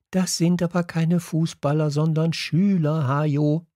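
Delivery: high-pitched, prissy correcting voice; protesting class teacher. A narrator's reading. Teacher keeps arguing with the coach. (protestierender Lehrer, hohe Stimme)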